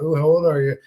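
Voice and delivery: normal talking voice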